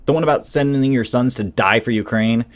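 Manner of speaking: usual speaking voice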